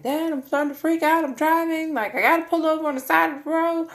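high, loud, fast frantic plea; retold panic. Daughter calling dad while driving, frantic and high.

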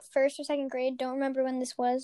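normal talking voice

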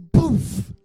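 comic impact noise; thud sound effect. Acted. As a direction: knock him over with a noise. Kicking over sound.

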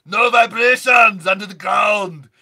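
loud, high shouted warning; hammed-up action-movie yell. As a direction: yell it to the whole crew. Acted movie line shouted.

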